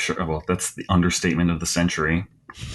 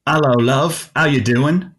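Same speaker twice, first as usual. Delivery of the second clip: higher-pitched, cheeky British hello; mock British accent; 6 semitones higher, 3 dB louder than usual. Guest redoing the greeting as a joke take, mock British accent. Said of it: "That was my horrible British accent".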